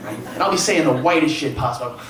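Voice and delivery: normal talking voice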